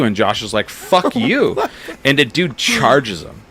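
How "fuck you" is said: mock angry swearing, much higher; retold cursing. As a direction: snap back at him. Josh answering the drunk.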